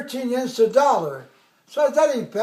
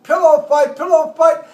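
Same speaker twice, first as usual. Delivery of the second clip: loud, high call to battle; retold angry yell; 5 semitones higher, 8 dB louder than usual. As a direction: shout it out to start the fight. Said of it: "I got pissed off".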